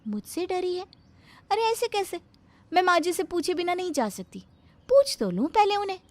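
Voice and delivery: high, loud, sweetly overeager; sugary bahu voice. Acted. Bahu asking her mother-in-law about cutting potatoes, sugary and high. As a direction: lay the sweetness on thick.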